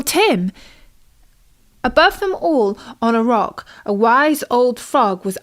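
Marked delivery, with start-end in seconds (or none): none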